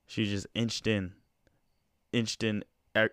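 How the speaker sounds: ordinary delivery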